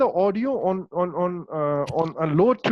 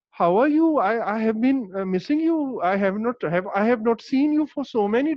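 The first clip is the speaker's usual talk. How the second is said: aunties' Urdu-stressed English; 3 semitones higher than usual. Retold or imitated. Teacher imitating Pakistani aunties' Urdu-stressed English. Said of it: "let me copy".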